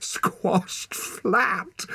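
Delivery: high gasp through giggles; boy helpless with laughter in the snow. Acted. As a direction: barely get it out for laughing. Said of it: "gasped Torak".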